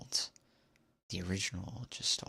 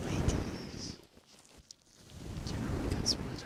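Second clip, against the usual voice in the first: whispering; 3 semitones higher than usual. Real, not acted. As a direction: keep it all in a hushed whisper. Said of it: "since I have to whisper".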